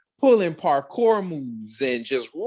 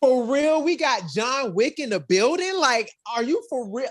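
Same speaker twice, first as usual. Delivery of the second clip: thrilled; 10 semitones higher than usual. Real, not acted. Thrilled by stunts.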